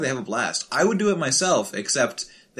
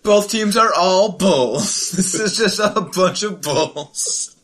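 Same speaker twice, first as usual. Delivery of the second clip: slow loud sportscaster call; announcer voice; 5 semitones higher, 4 dB louder, slower than usual. Pretend.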